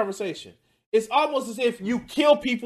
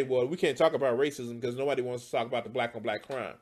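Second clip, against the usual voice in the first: mocking quote; 8 semitones lower, 5 dB quieter than usual. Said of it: "But the first thing that you say".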